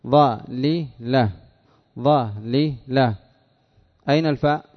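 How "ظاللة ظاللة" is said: "ظاللة" very slowly in three separate syllables, ẓa-li-la; syllable-by-syllable root form. Shown as a demonstration. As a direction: break "ظاللة" into ẓa, li, la with pauses. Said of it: "ما أصلها؟". ("ظاللة" مقطعة ببطء إلى ظَ، لِ، لَ)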